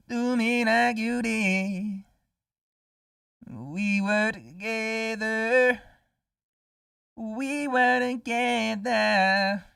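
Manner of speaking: high, slow cartoon sponge voice; SpongeBob impression. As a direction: stretch it out like SpongeBob. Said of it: "That was my SpongeBob impression".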